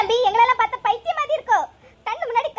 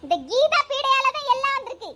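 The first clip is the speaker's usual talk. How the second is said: normal talking voice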